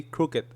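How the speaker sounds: "Crooked" ending in a light tongue-tap T, like "crookit"; subtle final T. Shown as a demonstration. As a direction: let the tongue just touch the palate ending "Crooked". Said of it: "like a subtle".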